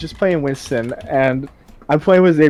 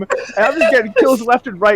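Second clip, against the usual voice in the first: ordinary delivery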